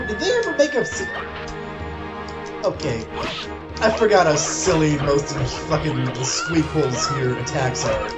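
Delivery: usual speaking voice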